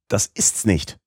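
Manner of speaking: a mild, understated shout of frustration. A narrator's reading. Uncle failing to decode the document, mild frustrated shout. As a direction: burst out that it's wrong. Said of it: "schrie mein Oheim".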